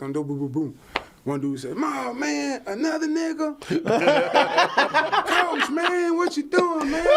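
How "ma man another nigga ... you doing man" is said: put-on high, laughing Florida accent; Florida-accented roommate, high and amused; mock Florida accent. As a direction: imitate the Florida teammate through laughter. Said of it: "With a Florida accent".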